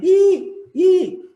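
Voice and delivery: grito agudo e estridente de Terezinha; Terezinha do Vai Que Cola. Retold or imitated. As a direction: solte um i bem alto e agudo, imitando. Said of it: "Tem uma Terezinha lá que ela diz". (imitação exagerada da Terezinha gritando i)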